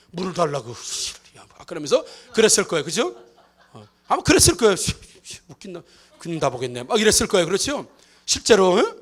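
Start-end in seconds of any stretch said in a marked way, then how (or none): 5.5-6.8 quiet, lower sneering grumble; a hint of sulky contempt; scoffing. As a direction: scoff at him under your breath. Woman scoffing at Jesus.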